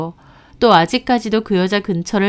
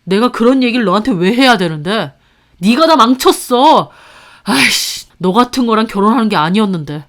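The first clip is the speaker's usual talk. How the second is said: loud, spiteful, faster at the end; bitter blame; 2 semitones higher, 5 dB louder than usual. Acted. Husband blaming his wife for ruining everything, loud and spiteful. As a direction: lash out with contempt.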